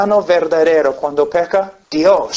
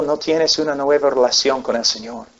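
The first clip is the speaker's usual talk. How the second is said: ordinary talk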